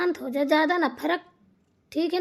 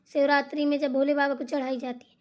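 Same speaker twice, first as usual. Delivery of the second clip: ordinary delivery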